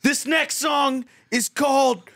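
high, slow hammed-up announcement; mock stage announcer. Friend imitating the stage roast, hammed-up announcing. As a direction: announce the song like a rock frontman. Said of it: "If you're in there".

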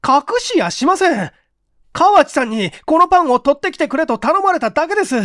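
put-on high, loud pleading young man; desperate plea. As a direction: plead frantically to be believed. Okamoto desperate excuse.

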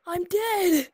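loud, high excited shout. Real, not acted. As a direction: shout it out thrilled. Game character died.

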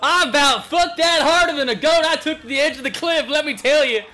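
loud, high talk breaking into cackles; laughing hard. Real, not acted. Streamer over a blown record run, loud laughing rant. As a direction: crack up loudly between phrases.

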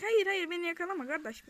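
loud high frightened begging; panicked cry at the chaser; panicked pleading. Real, not acted. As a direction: plead not to be caught. Begging a chasing enemy.

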